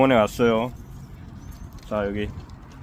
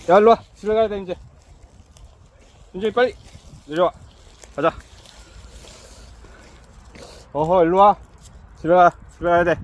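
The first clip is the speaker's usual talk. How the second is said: calling out; 5 semitones higher, 4 dB louder, faster than usual. Real, not acted. Calling dogs home.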